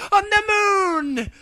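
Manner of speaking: very high, loud, slow triumphant cry; cried out. Acted. Luna sending the ponies off, crying out triumphantly. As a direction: fling it out as a grand finale. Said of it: "Luna cried".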